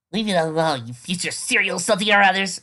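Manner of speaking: loud scolding yell. A narrator's reading. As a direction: shout it at the bullies. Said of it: "she yells".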